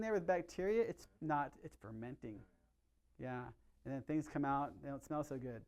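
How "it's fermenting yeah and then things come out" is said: ordinary delivery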